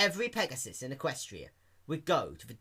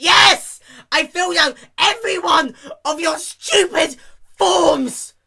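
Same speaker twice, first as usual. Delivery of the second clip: high, loud frantic shout; shouted desperate plea; exasperated begging; 12 semitones higher, 12 dB louder than usual. Acted. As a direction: beg while boiling over. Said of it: "Rainbow Dash begged".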